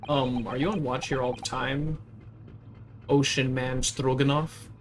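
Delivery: ordinary talk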